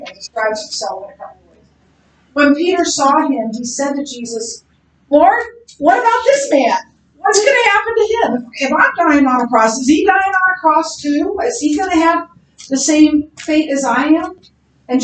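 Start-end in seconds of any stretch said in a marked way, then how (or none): 5.1-14.4 high, urgent worried questions; anxious pleading. Acted. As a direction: plead with rising alarm. Peter anxiously asking Jesus.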